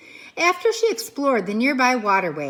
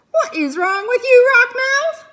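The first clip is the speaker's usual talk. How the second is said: high, loud, indignant shout; outraged cry; 13 semitones higher, 10 dB louder than usual. Acted. Mother beaver shouts at the pike who grabbed her son. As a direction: yell at the fish in outrage.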